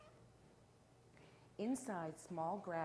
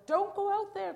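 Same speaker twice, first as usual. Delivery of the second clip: very high, loud warning shout; frantic storybook family crying out; panicked cry; 12 dB louder than usual. A narrator's reading. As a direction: shriek the warning in alarm. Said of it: "cried her family".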